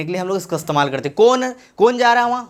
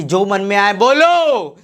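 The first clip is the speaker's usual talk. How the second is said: mock exhortation; 3 dB louder, slower than usual. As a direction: mimic people urging you on with a long swooping shout. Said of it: "जो कुछ लोग बोलते हैं ना". (नकल में ऊँची, खींची हुई पुकार)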